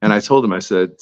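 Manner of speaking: normal talking voice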